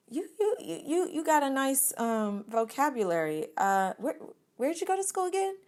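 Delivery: overly polite, barely holding it together; calm fake politeness. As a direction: hide the shock behind sweetness. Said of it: "I remain calm".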